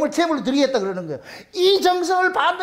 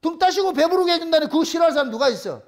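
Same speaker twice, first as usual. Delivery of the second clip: ordinary delivery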